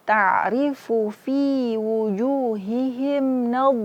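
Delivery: slow chanted reading of the verse; slow Quranic recitation. Shown as a demonstration. Teacher recites the full verse for students, slow chant. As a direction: recite the line slowly in tajwid style.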